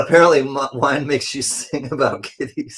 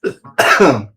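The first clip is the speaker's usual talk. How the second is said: real cough; 7 dB louder than usual. Real, not acted. Choked on wine.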